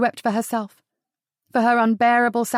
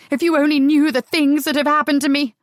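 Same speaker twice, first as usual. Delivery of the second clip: louder, higher anguished outburst; cried out; 5 semitones higher, 5 dB louder than usual. Acted. Sarah crying out at Adam, loud and hurt. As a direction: fling it at him in pain. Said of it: "she cried".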